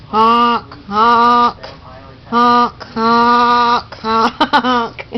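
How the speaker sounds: loud clownish honking; silly clown horn. Pretend. Playing horn game with bird.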